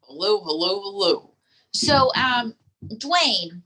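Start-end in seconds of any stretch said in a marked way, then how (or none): none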